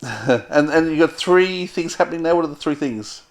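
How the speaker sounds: ordinary delivery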